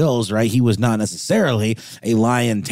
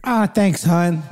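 high-pitched put-on wife's reply; voiced wife; 9 semitones higher, slower than usual. Wife thanking husband for the lion warning, high mock voice. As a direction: answer as the wife, pitched well up.

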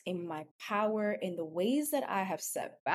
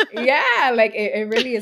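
laughing; 5 semitones higher, 13 dB louder than usual. Real, not acted. Laughing after joyful shout-out.

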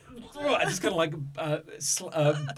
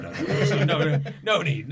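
loud, high string of protests; hammed-up panicky protesting; flustered refusal; 6 semitones higher, 9 dB louder than usual. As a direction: hurriedly wave the attention away, raised voice. Flustered character refusing attention.

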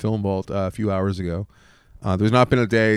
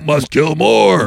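slow, loud, higher dumb brute voice; dopey cartoon bellow; 5 semitones higher, 8 dB louder, much slower than usual. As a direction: bellow it like a dim-witted brute. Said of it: "really dumb brute who's just really good at killing".

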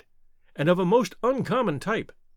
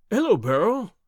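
not cordial; 3 semitones higher than usual. A narrator's reading. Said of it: "the tone of his greeting was not altogether a cordial one".

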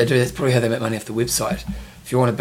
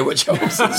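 laughing; 8 semitones higher, slower than usual. Real, not acted. Joking about the number.

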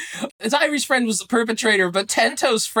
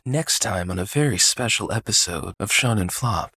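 low dramatic TV trailer voice; like a promo for next week's episode; trailer announcer voice; 13 semitones lower, 3 dB quieter than usual. Retold or imitated. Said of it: "I love the way that you said that".

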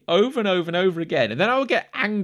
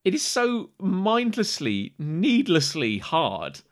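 slow emphasis; much slower than usual. Real, not acted. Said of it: "stress, slow".

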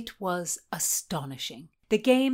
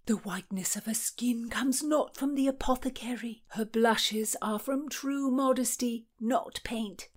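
awed whisper; 6 semitones higher than usual. A narrator's reading. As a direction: breathe it like a secret. Lovestruck Falk describing his lady.

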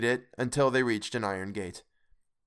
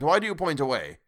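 higher-pitched urgent question; exclaiming; 6 semitones higher, 4 dB louder than usual. A narrator's reading. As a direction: exclaim it as a troubled plea. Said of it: "Scrooge exclaimed".

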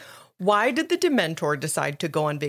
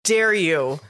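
mock offense; 3 semitones higher, 4 dB louder, much slower than usual.

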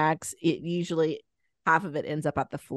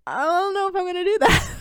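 much higher, laughing through it; laughing doubtful reply; 14 semitones higher, 4 dB louder than usual. Retold or imitated. Mother tells the aunt her doubts, laughing. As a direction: giggle it out, unsure. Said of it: "I was like".

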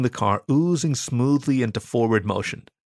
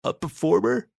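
high, tentative query; hesitant, awestruck guard; awed question; 9 semitones higher than usual. A narrator's reading. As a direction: ask it in high-pitched wonder. Awed guard keeps asking.

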